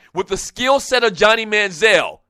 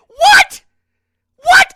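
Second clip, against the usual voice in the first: high, loud disbelieving what; shocked, can't believe it; incredulous what; 8 dB louder than usual. Real, not acted. Incredulous at pundit's claim.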